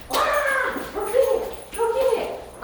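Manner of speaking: high, eager urging to fetch; excited dog call. Real, not acted. Trainer urging Ernie to chase the toy, excited high call. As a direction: pitch way up and cheer the dog on.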